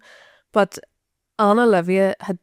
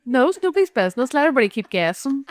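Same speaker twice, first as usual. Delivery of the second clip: playful tease; 3 semitones higher than usual. Real, not acted. Friend teases her to keep everyone guessing, fast and playful. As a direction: tease her, quick and light.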